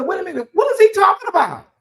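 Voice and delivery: very high-pitched disbelieving squeal; shocked disbelief. Retold or imitated. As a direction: shriek it in outrage, pitch way up. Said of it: "I said".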